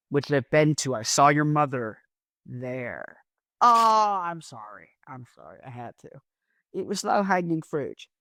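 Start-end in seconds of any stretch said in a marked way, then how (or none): none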